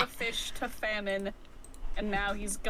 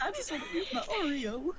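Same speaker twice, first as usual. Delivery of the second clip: higher sneering villain delivery; Scar-like villain voice; 8 semitones higher than usual. Acted. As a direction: redo it like a lion villain. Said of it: "something Scar would say".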